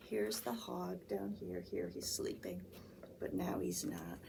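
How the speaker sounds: soft hushed whisper. Acted. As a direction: whisper so the hog sleeps. Whispering about sleeping hog.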